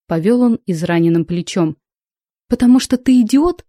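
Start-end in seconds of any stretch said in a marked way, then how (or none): 2.5-3.6 higher-pitched mocking question; scornful snort. A narrator's reading. Heroine mocking the pirate's theory, scornful. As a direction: toss back a scornful retort. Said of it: "фыркнула я".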